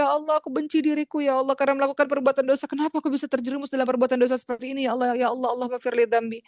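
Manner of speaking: wailing, self-reproaching prayer, acted out; imitated crying. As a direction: beg like a weeping sinner, high at first and gradually sinking. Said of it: "Kemudian dia nangis lagi".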